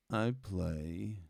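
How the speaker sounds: ordinary delivery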